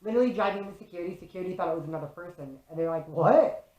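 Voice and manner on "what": loud rising what of disbelief; startled double take. Retold or imitated. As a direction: blurt it out, imitating a startled guard. Security startled by the sex doll.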